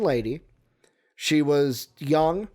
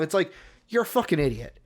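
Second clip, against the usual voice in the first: ordinary talk, nothing marked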